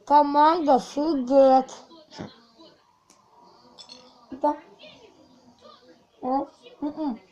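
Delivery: mouth full. Real, not acted. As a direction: praise and commands through a mouthful. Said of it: "Сложно говорить".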